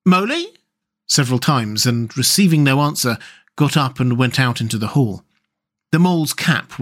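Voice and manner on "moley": high rising call; a questioning, high-pitched call. A narrator's reading. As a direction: call for a missing friend, voice lifting at the end. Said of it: "Then he called".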